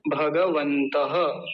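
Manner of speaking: भगवन्तः very slow with a clear final breathy ह after the visarga; slow careful pronunciation. Shown as a demonstration. Teacher modelling a Sanskrit word for learners, very slow. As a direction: spell out भगवन्तः slowly, sounding the visarga. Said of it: "मेरे साथ बोलिए".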